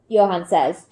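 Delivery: ordinary delivery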